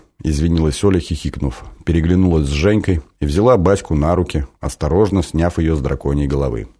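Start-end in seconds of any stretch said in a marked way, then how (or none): none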